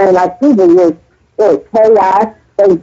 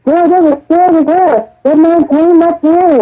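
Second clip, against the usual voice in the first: retold gossip; 7 semitones higher than usual. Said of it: "they come back and said".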